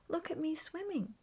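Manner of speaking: high, proud little squeak; squeaky Roo. A narrator's reading. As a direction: boast like a small child. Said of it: "squeaked Roo".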